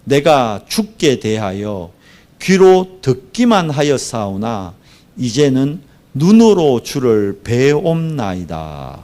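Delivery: slow, solemn declaration of a verse; emphatic scripture reading. A narrator's reading. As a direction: proclaim the verse slowly, drawing out each phrase. Reads key verse emphatically. (느리고 힘주어 선포하는 성경 봉독)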